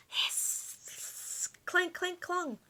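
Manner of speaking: hissing flame, then high clanking; playful hiss and metal clangs; hiss and clanks. Acted. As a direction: voice the flames and armor. Fire and armor sounds.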